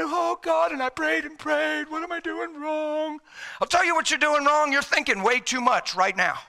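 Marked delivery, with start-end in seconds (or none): 0.0-3.2 plaintive monotone prayer, pitched a little up; sentimental lament. Acted. Preacher replaying his own grieving prayer to God, sentimental lament. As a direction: act out a softening, woeful plea. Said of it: "unless I get sentimental".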